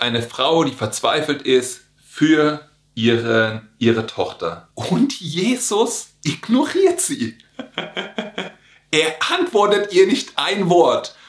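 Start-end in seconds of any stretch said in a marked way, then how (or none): 4.7-11.1 a hint of aghast laughter; mock disbelief. Preacher to church, mock shock that Jesus ignores her.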